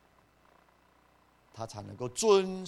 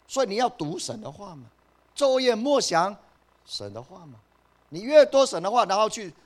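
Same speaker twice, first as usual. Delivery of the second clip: higher and louder, urgent exhortation; fiery preaching; 9 semitones higher, 8 dB louder than usual. Acted. Preacher urging the church to read God's word. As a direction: preach with rising fervour. Preacher rises to full force.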